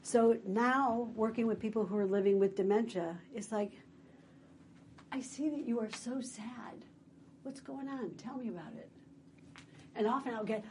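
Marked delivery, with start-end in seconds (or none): 5.1-8.9 soft, tender acknowledgement; gentle sympathy. Acted. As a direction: softly invite them to open up. Voicing a gentle reply to sadness.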